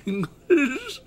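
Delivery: laughing. Real, not acted. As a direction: break into a laugh mid-phrase. Joking about American.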